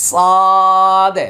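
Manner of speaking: সাদ held long, thick, lips unrounded; tajweed demo of সাদ; heavy letter with straight lips. Shown as a demonstration. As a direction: draw out সাদ slowly with the lips kept straight. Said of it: "একদম সোজা থাকবে".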